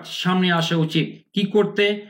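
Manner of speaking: normal talking voice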